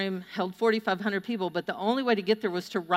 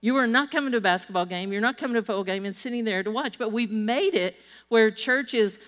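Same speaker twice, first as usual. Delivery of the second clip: ordinary talk, nothing marked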